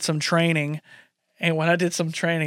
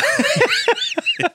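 much higher, gleeful laugh; high excited laugh. Retold or imitated. Imitating D'Angelo's reaction.

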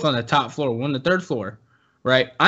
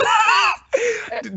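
high laugh. Real, not acted.

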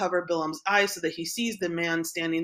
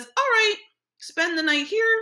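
much higher, louder, bright eager agreement; a playful retold Bilam, pitched up high; eager high reply; 13 semitones higher, 6 dB louder than usual. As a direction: jump in, keen to oblige. Bilam voiced answering Balak.

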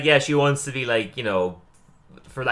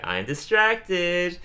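slow, lazy and pitched up; bored distracted mumble; 7 semitones higher, much slower than usual. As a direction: drag it out like you can't focus. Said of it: "He's like".

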